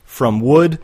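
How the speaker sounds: ordinary talk, nothing marked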